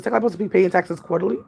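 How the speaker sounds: ordinary talk, nothing marked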